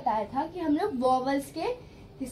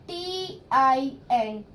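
slow spelling; much slower than usual. Shown as a demonstration. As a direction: spell बी आई एन slowly, letter by letter. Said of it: "टिन". (अक्षर-अक्षर धीरे बोलना)

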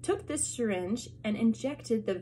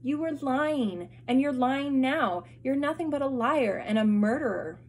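angry accusation; 5 semitones higher, 5 dB louder than usual. Acted. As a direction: hurl the accusation at the liar. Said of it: "Claus cried".

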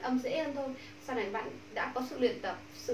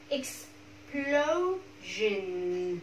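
explosion in separated, stretched syllables; very slow modelling; much slower than usual. Shown as a demonstration. Teacher leading class practice, explosion very slowly broken down. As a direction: draw out each syllable of explosion.

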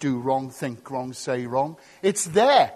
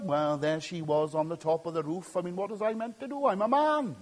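low, softer mock self-justification; blame-shifting excuse; 3 semitones lower, 5 dB quieter than usual. Preacher mocks the excuse David didn't make, blame-shifting. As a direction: wheedle a quick excuse. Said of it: "He doesn't blame Bathsheba".

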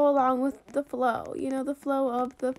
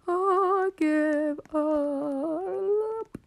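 I give up, stretched very slowly; drawn-out defeated give up; 3 semitones higher, much slower than usual. Real, not acted. Player giving up on a failed trade, stretched-out groan. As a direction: stretch each word out like you've lost all hope.

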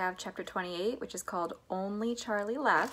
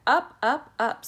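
tag; 5 semitones higher, 10 dB louder, slower than usual. A narrator's reading. Narrator builds the elevator's climb.

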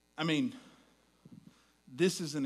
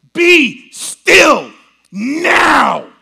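very slow, much higher and far louder shout; angry rebuke; 11 semitones higher, 22 dB louder, much slower than usual. Retold or imitated. Jesus rebuking the wind and sea, furious shout. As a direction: rebuke the storm with full anger. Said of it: "the anger in the voice".

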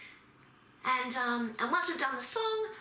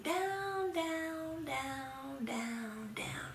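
descending landing; 5 dB quieter, much slower than usual. Pretend. Teacher landing a pretend plane, gradually sinking and fading. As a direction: let each word glide down, fading to almost nothing.

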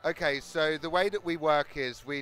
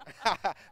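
short laugh; 4 semitones higher, 5 dB louder than usual. Real, not acted. Laughs at own accent.

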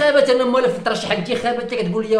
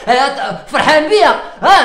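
usual speaking voice

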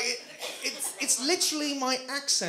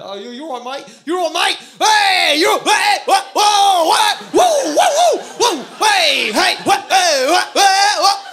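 put-on botched British accent escalating into shouting; hammed-up British lad hollering; bad British accent; 9 semitones higher, 16 dB louder, slower than usual. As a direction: start with a mangled British greeting, build to loud high yelling. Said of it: "I wasn't that good at the British accent at first".

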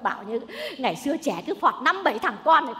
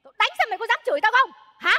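raised, indignant challenge; angry threat; 5 semitones higher, faster than usual. Acted. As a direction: threaten like an outraged mother-in-law. Mother-in-law threatens to hit daughter-in-law.

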